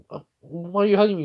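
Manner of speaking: puzzled question. Retold or imitated. Puzzled girl being hugged in story.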